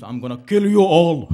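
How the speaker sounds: loud mock threat, punched out; mock menacing threat. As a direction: bellow it like a comic villain. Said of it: "it means".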